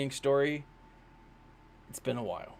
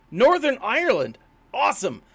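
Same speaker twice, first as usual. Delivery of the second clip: excited; 8 semitones higher, 5 dB louder than usual. Real, not acted. Reacting to viewer's location.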